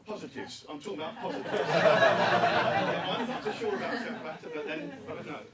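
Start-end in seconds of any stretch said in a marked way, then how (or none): 1.5-5.4 loud laughter through the words; laughing. Real, not acted. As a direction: laugh it out, amused and doubtful. Laughs at Sepp Blatter suggestion.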